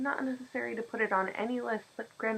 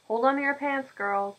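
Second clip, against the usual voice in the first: louder, higher teasing scold; gruff call; 6 semitones higher, 7 dB louder than usual. Acted. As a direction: rein her in with a loud tease. Old man scolding girl.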